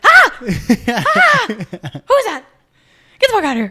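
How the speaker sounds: high, loud, laughing fake terror; hammed-up screaming, laughing through it; mock scream. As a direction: shriek at the stranger in the mirror. Screaming at mirror self.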